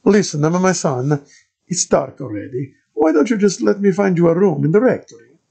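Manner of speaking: put-on high, loud thick Italian accent of a friar; Italian-accented padre greeting a stranger. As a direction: play the monk in a heavy Italian accent. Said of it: "the thickest Italian accent I'd ever heard".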